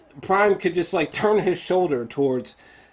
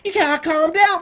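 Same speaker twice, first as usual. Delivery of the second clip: much higher, louder mock plea; hammed-up high plea to an evil robot; pleading Mark; 12 semitones higher, 5 dB louder than usual. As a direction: whine at the robot to calm down. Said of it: "he's like".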